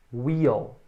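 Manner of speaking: Wheel with a long stretched ee. Shown as a demonstration. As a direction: draw out the ee in "Wheel". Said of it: "esse I um pouquinho mais longo".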